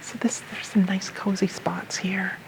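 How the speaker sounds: hushed whisper for a quiet library. Real, not acted. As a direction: whisper so you don't disturb readers. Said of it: "we're using our library voices now".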